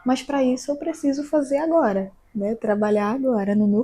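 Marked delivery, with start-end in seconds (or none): none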